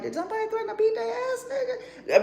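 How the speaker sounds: very high, fast alarmed voice; mock alarmed squeak.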